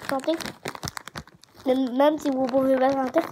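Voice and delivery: talking between crunchy bites; chewing while talking. Real, not acted. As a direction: mouth partly full of chips. Seen 1: bites chip at 8-9s, chewing with food in mouth throughout.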